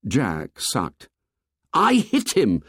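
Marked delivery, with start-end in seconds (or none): 1.7-2.6 high indignant insistence; indignant boyish protest. A narrator's reading. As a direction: insist on it, pitched up. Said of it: "said Ralph indignantly".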